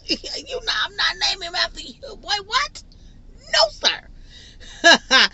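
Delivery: high laughing no way; laughing refusal. Real, not acted. Refusing to name the baby junior, laughing high. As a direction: laugh him off, voice shooting up.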